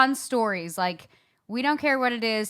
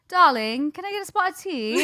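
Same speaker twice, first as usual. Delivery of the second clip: mock British-Australian tea-time voice; playful British-Australian lilt; British-Australian accent; 3 semitones higher than usual. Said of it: "a mix between British and Australian".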